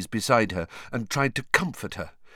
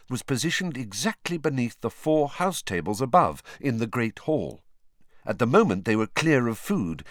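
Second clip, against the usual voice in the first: ordinary delivery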